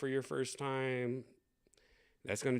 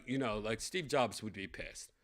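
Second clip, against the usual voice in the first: normal talking voice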